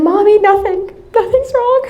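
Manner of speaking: high-pitched, upset little denial; imitated tearful child. Crying daughter denying anything to her mother, tearful. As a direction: voice a crying kid insisting all is fine. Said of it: "she's crying and we're both crying".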